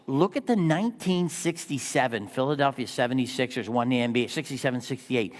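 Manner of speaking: normal talking voice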